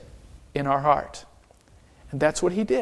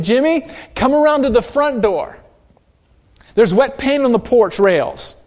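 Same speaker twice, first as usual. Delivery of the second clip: put-on raised voice calling a boy from the window; 5 semitones higher, 4 dB louder than usual. Mother at the window warns neighbor boy about paint, called out loudly. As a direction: call out to the neighbor boy, a bit higher and louder. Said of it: "she hollered out the window".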